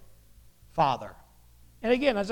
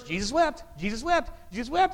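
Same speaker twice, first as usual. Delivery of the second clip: higher, quick chirp rising at the end; eager kid reciting; 4 semitones higher than usual. Acted. Kid shows off the favorite memory verse, eagerly. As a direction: imitate a child rattling off a memory verse. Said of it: "every Awana kid's favorite Bible verse".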